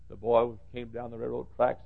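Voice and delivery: ordinary delivery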